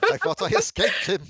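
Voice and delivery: loud posh whine, laughing. Acted. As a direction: laugh through the posh lament. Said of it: "the other posh voice going".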